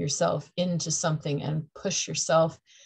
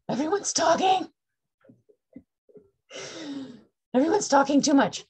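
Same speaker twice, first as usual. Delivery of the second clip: mock chatter; 10 semitones higher, 3 dB louder than usual. Mimicking chatty Libra energy.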